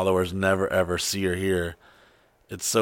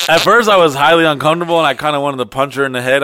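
loud outburst; 5 semitones higher, 8 dB louder, faster than usual. Real, not acted. Uncomfortable retelling, loud.

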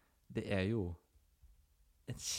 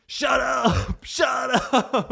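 yelled at the top of the lungs; shouting; 14 dB louder, much slower than usual. Retold or imitated. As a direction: scream it right at her. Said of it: "Så han skriker til henne".

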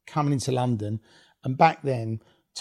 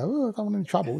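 mock timid; 8 semitones higher, faster than usual. Mocking someone backing off scared.

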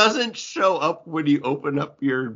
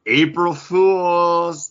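slow reveal; 5 semitones higher, 4 dB louder, much slower than usual. Acted. Revealing the prank.

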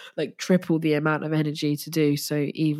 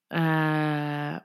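tearful sob. Real, not acted. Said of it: "not cry".

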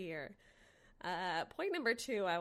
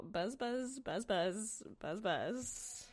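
playful buzzing; 3 semitones higher, much slower than usual. Pretend. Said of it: "podcast buzzing bit".

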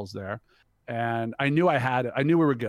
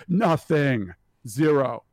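"Nothing" and "Zero" each punched loud; dismissive emphasis; 3 semitones higher, 8 dB louder, much slower than usual. Retold or imitated. Buyer dismissing the town, "Nothing" and "Zero" hit hard. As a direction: land "Nothing" and "Zero" as separate loud verdicts.

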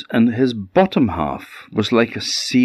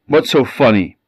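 irritated; 5 semitones higher, 5 dB louder, faster than usual. Acted. Fisherman irritated at merman again.

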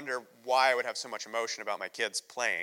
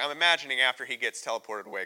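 normal talking voice

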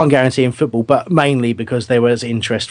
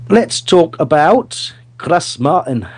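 slow, higher-pitched, put-on Scottish accent; 6 semitones higher, much slower than usual. Podcast host introducing a player in a put-on Scottish accent. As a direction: attempt a muddled Scottish accent, drawn out. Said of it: "do Dougie quotes in a Scottish accent".

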